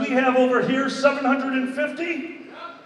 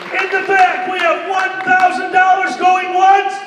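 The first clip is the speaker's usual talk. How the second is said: auction calling; 6 semitones higher than usual. Real, not acted. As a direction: shout the bids to the back of the hall.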